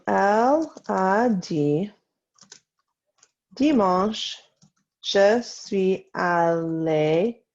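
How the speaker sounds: drawn-out, spaced French words; slow dictation. Shown as a demonstration. Teacher to class copying Sunday's sentence, slow dictation. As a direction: stretch each word slowly for writers.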